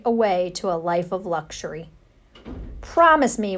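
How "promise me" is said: louder, higher cry of a worried mother; mother crying out as he leaves; cried out. Acted. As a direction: call after him urgently. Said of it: "Ralph cried".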